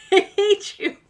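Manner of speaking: a fond, giggling "I hate you"; laughing. Real, not acted.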